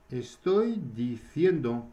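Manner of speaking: slow model phrase. Shown as a demonstration. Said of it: "on va rajouter yendo".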